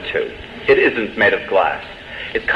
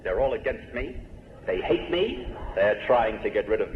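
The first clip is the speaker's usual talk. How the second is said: soft, hushed paranoid complaint; paranoid whine; 2 semitones lower, 9 dB quieter than usual. Retold or imitated. A paranoid patient quietly complaining to the audience, hushed. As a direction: mutter it like everyone's out to get you. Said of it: "who talks like this".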